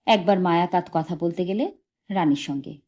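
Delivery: ordinary delivery, nothing marked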